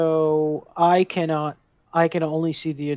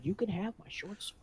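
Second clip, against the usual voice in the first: soft, raised-pitch whisper; 6 semitones higher, 14 dB quieter than usual. Acted. Adventurer quietly offering a short sword, whispered. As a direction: keep the offer hushed. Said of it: "you're whispering this to me".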